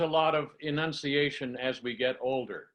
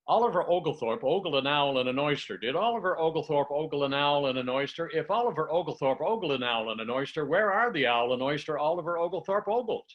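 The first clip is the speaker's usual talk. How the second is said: one breath, no pauses, keep it flowing. Shown as a demonstration. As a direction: rattle the whole twister off in a single breath. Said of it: "take a breath and do the very first one".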